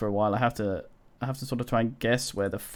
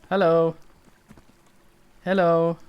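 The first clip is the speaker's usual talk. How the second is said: calling out; 6 semitones higher, 5 dB louder than usual. Real, not acted. Player hailing a game character, calling out. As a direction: call out to get someone's attention.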